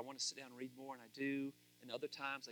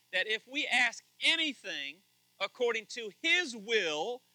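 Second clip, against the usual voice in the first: louder, slow, weighted on every phrase; forceful scripture reading; 6 semitones higher, 10 dB louder, slower than usual. Acted. Pastor reading First John to his congregation, slow and forceful. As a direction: preach each word of First John as a promise.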